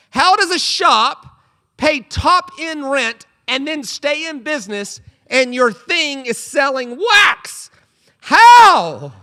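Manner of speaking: high, loud and slow, building to a squeal; disbelieving rant. Real, not acted. Comedian baffled that a wax shop survives, rising disbelief. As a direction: hammer the outrage, word by word.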